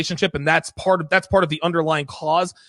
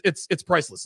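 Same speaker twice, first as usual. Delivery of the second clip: normal talking voice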